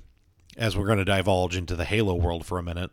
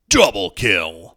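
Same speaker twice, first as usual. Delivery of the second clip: slow, booming game announcer; Halo announcer voice; 9 dB louder, much slower than usual. Retold or imitated. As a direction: drag it out like an arena announcer. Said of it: "the dude who did the voice of all the Halo stuff".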